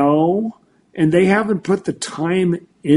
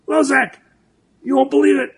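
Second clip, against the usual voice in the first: much higher, amazed mentor voice; excited mentor; 9 semitones higher than usual. Retold or imitated. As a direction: blurt it out like a mentor who finally sees it. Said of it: "he calls me up and he goes".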